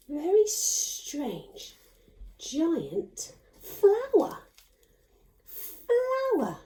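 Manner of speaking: slow, drawn-out storybook wonder; mock wonder. Teacher marvelling at the puppet's giant flower for the children. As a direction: stretch the words in mock awe.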